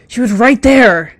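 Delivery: loud, high, drawn-out shout; furious yowl. A narrator's reading. Cat raging that his quarry got away, furious yowl. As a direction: yowl it out in frustration, slow and full force. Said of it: "he yowled".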